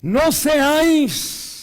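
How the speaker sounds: very slow, high and loud; drawn-out proclamation. Real, not acted. Preacher proclaiming the verse on fasting. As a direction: stretch each syllable out. Reading scripture emphatically.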